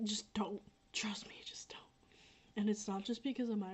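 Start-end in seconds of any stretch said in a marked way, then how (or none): 0.0-1.8 whisper. Real, not acted. Confiding warning.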